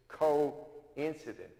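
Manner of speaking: "coincident" stretched out slowly; drawn-out word. Shown as a demonstration. Minister savouring the word for the congregation, slowly. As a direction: linger over each syllable of "coincident". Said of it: "this word coincidence".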